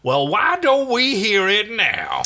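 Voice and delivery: high, loud and slow; manic demand. Acted. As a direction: drag each word out, taunting. Crichton demanding an answer.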